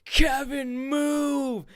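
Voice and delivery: put-on shrill high yell of a kid's name; a kid's big shouted warning. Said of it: "and I'm like".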